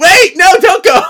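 high, loud comic yell; comic frantic yell; frantic shout. Acted. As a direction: shout to stop them in mock panic. Paige calling them back.

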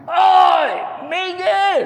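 very loud, high drawn-out yell; full shout. Retold or imitated. Preacher yelling at wrong-way riders from the car, shouting. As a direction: bellow to clear the road. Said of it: "saya teriak".

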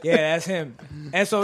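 ordinary talk, nothing marked

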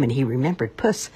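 plain reading voice

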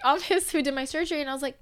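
ordinary delivery

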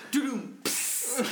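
high double knock drawn into a hiss; bottle breaking noise. Shown as a demonstration. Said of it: "it was like this".

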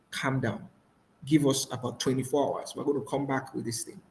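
low, soft, composed answer; calm reply. Acted. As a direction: defuse an angry boss quietly. Calm reply to a shouting boss.

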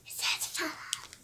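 unvoiced whisper. Real, not acted. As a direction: breathe it out softly, barely audible.

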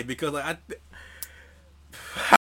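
slow breathy whisper, dragged out; whispered mock stammer. Mocking his own stumble to the co-host, slow whisper. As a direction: whisper it slowly, teasing the stumble.

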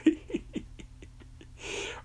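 long, high, wheezing cackle; a long, high giggle fit; high squealing laugh. Acted. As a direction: shriek with helpless laughter. Cracking up.

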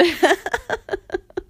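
high bright laugh; a quick giggle at yourself. Real, not acted. Laughs at her own remark.

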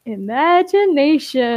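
drawn-out, showy delivery; grand reveal. A narrator's reading. Grape reveals the cheat to Jumper, grand and drawn-out. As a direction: stretch it out like a magic reveal. Character spreads hands, reveals word.

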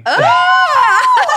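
high, loud shocked yelp; surprised shriek. Real, not acted. Reacting to a crude dad joke.

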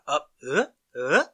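"uh" flicked sharply upward like a question; uptalk lilt. Shown as a demonstration. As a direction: mimic Valley Girl "uh" with a leap up at the end. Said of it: "at the end of everything".

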